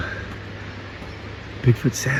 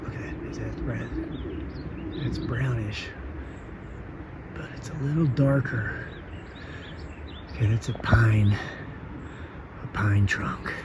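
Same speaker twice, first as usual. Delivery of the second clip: hushed tense whisper; 16 dB quieter than usual. Real, not acted. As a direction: whisper while watching something. Whispering, spotting something brown.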